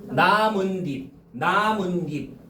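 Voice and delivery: 나문딥 drawn out slowly as na-mun-nip; demonstrated pronunciation. Shown as a demonstration. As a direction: stretch each syllable of 나문딥, double n in the middle. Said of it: "우리 발음해봐". ("나문닙"으로 천천히 늘여서)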